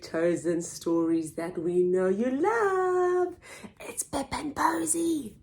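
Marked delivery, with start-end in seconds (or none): 3.8-5.3 secretive breathy whisper of the title; hushed whisper. Acted.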